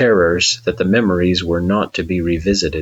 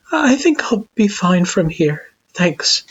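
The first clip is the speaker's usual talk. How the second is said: high Frisk voice; 11 semitones higher than usual. A narrator's reading. Frisk reassures.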